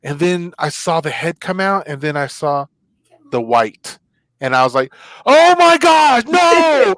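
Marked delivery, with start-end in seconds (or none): 5.2-6.9 loud, high, drawn-out cry of panic; horrified scream. Retold or imitated. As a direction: reenact a full-out shriek of horror.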